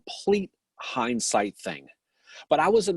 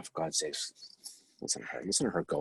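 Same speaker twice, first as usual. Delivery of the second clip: muttered aside; 9 semitones lower, 10 dB quieter than usual. Real, not acted. Muttering aside about cohost.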